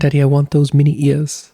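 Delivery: mock toddler voice; imitating baby daughter. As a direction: mimic a tiny child asking Daddy for a treat. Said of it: "She didn't talk like that".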